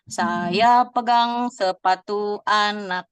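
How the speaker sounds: very slow, deliberate "Saya pegang sepatu anak"; slow example line. Shown as a demonstration. Teacher modelling a description for the participants, slow and clear. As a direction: spell it out slowly for the class.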